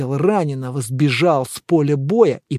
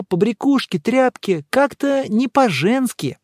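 higher-pitched, emphatic dialogue voice; lively, judgmental higher voice; opinionated character voice; 8 semitones higher, 3 dB louder than usual. Acted. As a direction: voice a disapproving friend in a raised pitch. Добродеев judges an absent man.

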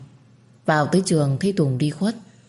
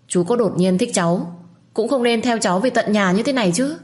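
higher, raised shout back over the shoulder; shouting while running; 6 semitones higher than usual. A narrator's reading. Girl fleeing the man on the motorbike, shouts back higher. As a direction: yell it back as you flee. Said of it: "Tôi chạy và gào lên".

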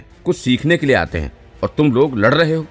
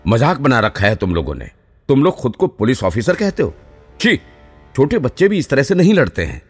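higher-pitched officer's reprimand; angry scolding; 6 semitones higher than usual. A narrator's reading. Training officer to fighting trainees, angry scolding. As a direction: scold them like squabbling children. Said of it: "गुस्से से चिल्ला रहे थे".

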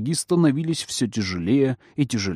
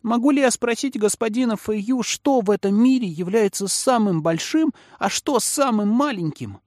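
put-on high-pitched little kid asking a question; ringing child's voice; 9 semitones higher than usual. A narrator's reading. Child politely asking Mr. Feiyu a question, ringing childish voice. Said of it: "произнёс своим звонким детским голосом".

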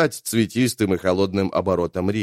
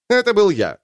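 smug; 11 semitones higher, 4 dB louder than usual. Acted. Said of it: "не без самодовольства заявил".

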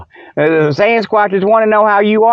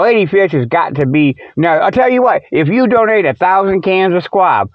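ordinary talk, nothing marked

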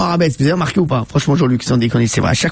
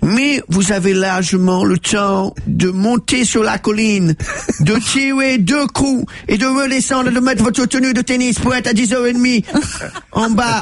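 put-on slow, high detective accent; drawn-out English-accented French; 7 semitones higher, slower than usual. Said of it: "Il fait ça, là".

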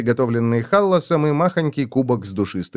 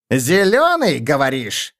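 угрожающий переспрос; slower than usual. A narrator's reading. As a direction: throw the insult back as a warning. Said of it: "Кликли опасно прищурился". (с угрозой переспросить)

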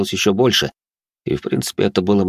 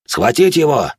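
shouted order; 7 semitones higher, 5 dB louder than usual. Acted. Soldiers shouting orders from wall.

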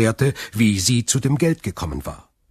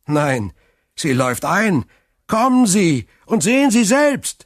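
much higher and louder, urgent; insistent excitement; 10 semitones higher, 6 dB louder than usual. Acted. Clerk urges Morel to come see the ship, excited. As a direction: convince him, beaming.

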